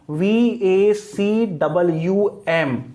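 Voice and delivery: very slow, separated letters; measured classroom spelling; spelled out slowly. Shown as a demonstration. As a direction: dictate it one letter at a time. Spelling out word for students.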